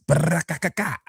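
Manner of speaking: rattling mouth-made gunshot burst; rapid-fire vocal shots with a rolled start; playful machine-gun noise; imitated gunfire. Imitates shots after "super shots.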